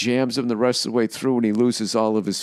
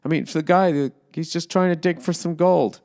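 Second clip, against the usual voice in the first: mock sympathetic writers; 8 semitones higher than usual. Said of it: "They're like".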